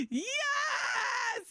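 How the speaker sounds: retold yes scream. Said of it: "lifted it up and screamed".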